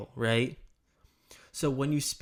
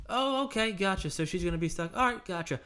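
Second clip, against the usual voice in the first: higher-pitched, breezy upbeat acknowledgement; friendly bubbly positivity; 5 semitones higher than usual. Shown as a demonstration. Sales trainer mocking the wrong tone for death talk, friendly and bubbly. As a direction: chirp it like good news, missing the gravity. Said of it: "you don't sound like this".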